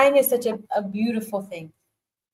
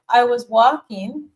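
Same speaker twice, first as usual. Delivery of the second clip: normal talking voice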